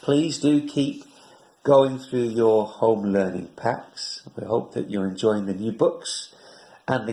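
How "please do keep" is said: ordinary delivery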